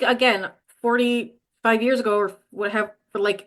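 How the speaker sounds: usual speaking voice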